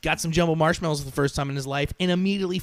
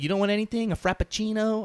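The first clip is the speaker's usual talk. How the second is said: cheerful passenger; 7 semitones higher than usual. Retold or imitated. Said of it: "he's all cheerful".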